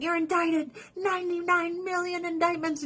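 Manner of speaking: high-pitched parody of prosecutors; high, hammed-up accusation; mock indictment. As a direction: pronounce charges in a high mock voice. Voicing prosecutors again.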